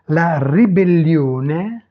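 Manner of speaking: "La ribellione" slow, with rolled r; Italian pronunciation demo. Shown as a demonstration. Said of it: "prononcer les mots". (prononcer « La ribellione » lentement, à l'italienne)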